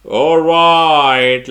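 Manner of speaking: loud, high, long drawn-out "All right"; calling time. Real, not acted. Teacher announces time is up to students, calling out. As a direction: call it out and stretch the vowel.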